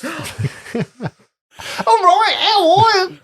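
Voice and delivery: high, squealed impression through laughter. Podcaster doing a party-piece impression for co-hosts, high squealed mimicry. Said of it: "That's my [name] impression".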